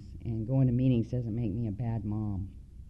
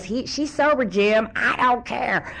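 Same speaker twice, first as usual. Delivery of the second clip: high hollered relay and angry retort; mock family shouting; 10 semitones higher, 4 dB louder, slower than usual. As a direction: act out a couple yelling back and forth. Stepmom relays, dad shouts back.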